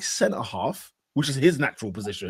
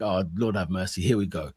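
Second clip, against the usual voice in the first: low, soft retold resignation; quoted inner groan, dropped low and soft; resigned muttering; 7 semitones lower, 5 dB quieter than usual. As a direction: mutter it to yourself, bracing for trouble. Retelling his dread at the defending.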